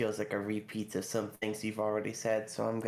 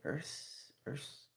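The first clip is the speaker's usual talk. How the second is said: whispered try; 2 semitones higher, 11 dB quieter than usual. Real, not acted. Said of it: "is that how you pronounce it?".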